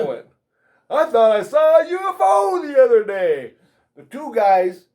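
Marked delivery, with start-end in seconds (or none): 0.9-3.5 high, loud, for the whole room; screamed announcement. Retold or imitated. Telling coworker so the agents hear, loud shout. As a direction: broadcast it across the shop. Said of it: "I'm gonna scream it out loud".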